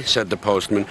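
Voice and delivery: usual speaking voice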